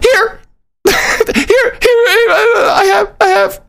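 high, crying, frantic mock voice; high mock whimper of an eager wife; obedient panic. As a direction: hand it over in a whimpering panic. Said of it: "She's like".

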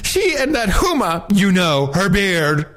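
high, camp gossip. Acted. Gossiping to John about Hillary and Huma, high camp. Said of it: "you can be gayer than that".

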